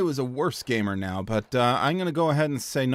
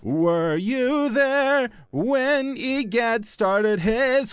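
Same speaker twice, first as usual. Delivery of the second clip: put-on old character voice; 6 semitones higher, much slower than usual.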